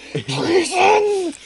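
mock stifled scream. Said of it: "Quietly screams into his hell".

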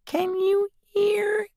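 tiny, very high, slow voice; whispering Mouse. A narrator's reading. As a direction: squeak it secretively, drawn out. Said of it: "and whispered".

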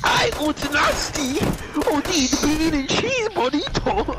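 high, slow, badly faked Chinese accent; hammed-up Chinese accent, pitched high; mock Chinese accent. Hitler answering Mister Satan, accidental mock Chinese accent. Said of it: "why I'm making him Chinese".

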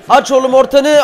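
high, loud call to a winger; urgent shout. Real, not acted. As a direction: yell for the ball to come in. Calls for a cross.

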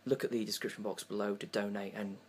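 sincere donation plea, hushed; charity appeal. Acted.

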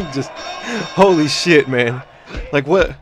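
ordinary talk, nothing marked

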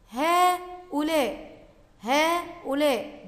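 هؤلاء slowly, with a long "haaa" to open; long alif in هؤلاء. Shown as a demonstration. Teacher showing pupils a hidden alif, هؤلاء slow and stretched. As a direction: stretch the first syllable of هؤلاء, "haaa-ulaa". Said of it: "بعد الهاء ألف تنطق".

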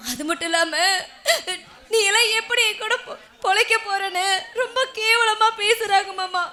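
acted sobbing through hurt words; tearful complaint. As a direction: cry while pleading with a husband. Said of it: "ஏன் அழுவுற?".

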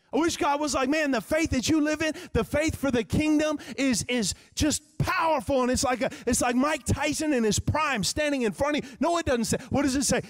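high, hyped-up preacher voice; full-force preaching. Real, not acted. Preacher wishing God described faith as powerful, hyped-up preaching. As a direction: pump it up with big emphasis. Preacher hypes an imagined verse.

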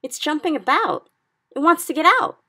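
high, loud rising alarm; frightened cry. A narrator's reading. Sophie cries to the giant that the nightmare wants out, high. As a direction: panic as it thrashes in the jar. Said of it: "Sophie cried".

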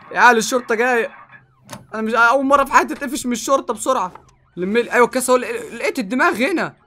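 high, loud frantic calling; panicked urgency. Real, not acted. Player loots as police approach, calling out frantically. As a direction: rush to grab things in a panic.

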